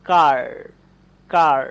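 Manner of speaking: কার loud with a long held aa; long aa in car. Shown as a demonstration. Teacher demonstrating a long vowel loudly. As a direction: stretch and project the aa in কার. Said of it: "সময় অতি অবশ্যই বেশি দিতে হবে".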